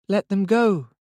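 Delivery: put-on high child's voice with little expression; expressionless, dull child. A narrator's reading. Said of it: "expressionless and dull".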